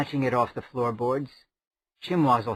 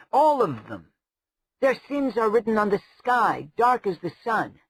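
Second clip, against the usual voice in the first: higher, rising list of wizard kinds; bitter tirade; 7 semitones higher than usual. A narrator's reading. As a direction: rail against every sort of wizard. Lirian's tirade against wizards.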